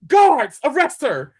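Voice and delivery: high, loud shout of an order; commanding shout. Retold or imitated. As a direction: bark the order at the guards. Emperor ordering the guards.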